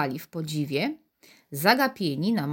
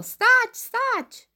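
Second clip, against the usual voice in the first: very high, loud shouted command; mother shouting as she runs; 4 dB louder than usual. A narrator's reading. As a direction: bark it like a frantic mother. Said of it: "pokrzykująca".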